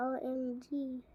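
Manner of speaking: normal talking voice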